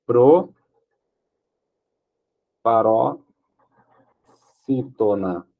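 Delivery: drawn out syllable by syllable; dictating slowly while writing on the board. Shown as a demonstration. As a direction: stretch "proparoxítona" out slowly, each syllable apart. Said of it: "aqui eu tenho um caso de".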